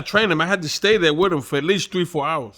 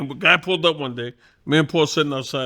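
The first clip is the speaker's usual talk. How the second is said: ordinary talk, nothing marked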